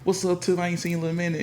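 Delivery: high, excited hey-there greeting; greeting a friend. Retold or imitated. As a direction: call out to an old friend you spotted. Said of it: "And I was like".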